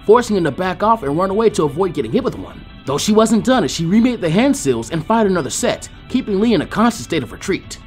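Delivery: usual speaking voice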